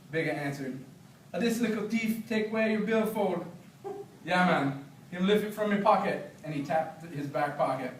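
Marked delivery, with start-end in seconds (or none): 1.3-6.3 lightly voiced Jamaican patois; easy Jamaican patois chat. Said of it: "read some patois so you can hear".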